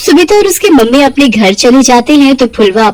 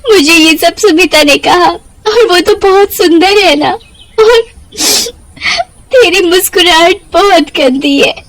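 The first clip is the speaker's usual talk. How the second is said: higher, tearful telling; crying; 8 semitones higher than usual. Acted. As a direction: sob out what she was told. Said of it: "ऐसे क्यों रो रही हो". (रोते हुए माँ को बताती, ऊँची आवाज़)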